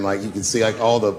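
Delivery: ordinary delivery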